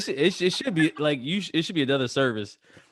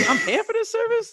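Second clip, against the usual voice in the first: laughing mock protest; 3 dB louder than usual. Justifying being cursed by own app.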